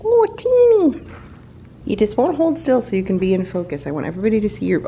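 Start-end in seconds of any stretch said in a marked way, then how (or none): 0.0-2.6 high pet-talk squeal to the cat; cooing at the cat. Real, not acted. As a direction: coo the cat's name way up high.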